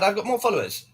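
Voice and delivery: ordinary talk, nothing marked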